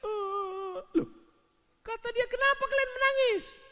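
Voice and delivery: high, surprised voice; bereaved husband. Acted. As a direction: act the puzzled widower. (suami yang heran)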